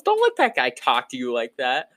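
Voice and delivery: lightly voiced scolding partner; mock telling-off of a coworker. Partner urges ranger to stand up to driver, light mock scolding. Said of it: "She goes".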